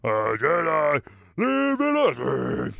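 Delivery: half-shouted try at a masked Sith; failed villain impression. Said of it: "I can't get my voice down".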